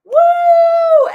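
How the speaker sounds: very high, loud mock whoop; mock cheering. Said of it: "everyone's like".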